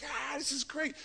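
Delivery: high, soft delighted sigh; a light mock happy child; delighted boy. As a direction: sound thrilled like a kid. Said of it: "He was like".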